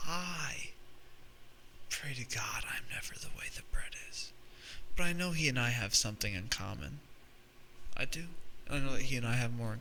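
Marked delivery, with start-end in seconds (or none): 0.1-4.3 hushed whispered prayer. Real, not acted. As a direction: breathe it like a secret wish. Confessional aside to audience.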